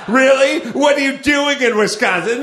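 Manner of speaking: high, loud, astonished questioning; hammed-up disbelief; mock incredulity. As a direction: act amazed and demand an explanation. Imagined nosy follow-up question.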